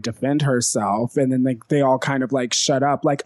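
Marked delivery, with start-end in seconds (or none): none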